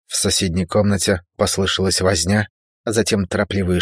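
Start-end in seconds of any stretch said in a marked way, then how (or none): none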